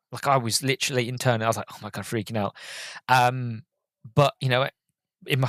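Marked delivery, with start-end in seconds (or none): none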